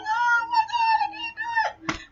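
very high squealed fake cry; hammed-up overwhelmed wail; mock wailing. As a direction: squeal it like a comic meltdown. Mock breakdown from overflowing emotion.

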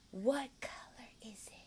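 soft whispered question; hushed teacher. Shown as a demonstration. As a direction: whisper it like a secret. Teacher demo to child.

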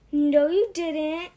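put-on loud, slow child's snap; snapped. Crying boy snaps at the monster, loud. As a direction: snap back hard. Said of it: "Sam snapped".

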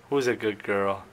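low, soft, cooing praise; cooing pet talk. Real, not acted. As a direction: coo it softly to the bird.

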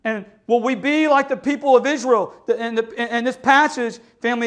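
ordinary talk

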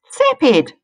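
high, loud mocking jeer; mocking snicker. Skink taunting the slug, loud mocking jeer. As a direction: sneer it like a taunt. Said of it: "snickered a skink".

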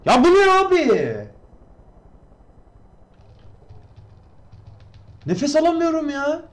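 high-pitched breathless panic; panicked shriek. Real, not acted. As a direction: squeal in overwhelmed panic. Being shot at, panicking.

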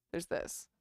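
breathy whisper. Real, not acted. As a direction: say it under the breath. Whispered aside.

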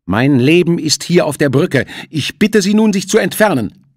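high, loud, firm refusal; defiant captain. Acted. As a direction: stand your ground, loud and firm. Character dialogue in audiobook.

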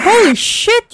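normal talking voice

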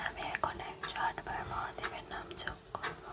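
barely audible real whisper. Real, not acted. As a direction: whisper it so the sleepers don't wake. Whispering while parents sleep nearby. (খুব নিচু স্বরে ফিসফিস করে)

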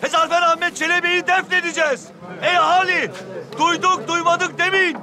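town crier. Acted. Mock crier announcing funeral.

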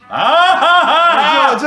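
loud, high laughing cries; half laughing, half crying out, in full panic; laughing shout. Real, not acted. Player losing it at the controls, loud laughing cries.

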